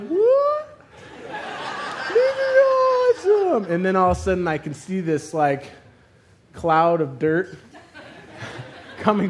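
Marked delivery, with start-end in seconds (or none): 0.0-3.7 very high, slow stretched-out cheer; elated drawn-out falsetto; oblivious excitement. Retold or imitated. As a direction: holler gleefully in slow motion. Obliviously excited inside slow-motion moment.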